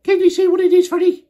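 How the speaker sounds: very high, loud squeaky voice; wrong voice for Joe. Acted. Child reading Joe's line in the wrong voice, high and loud. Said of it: "That's not his voice".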